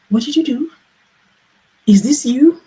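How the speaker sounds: high, loud shocked husband; utter shock. Retold or imitated. Husband sees her new haircut, high loud utter shock. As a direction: blurt it out in disbelief. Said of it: "he was in utter shock".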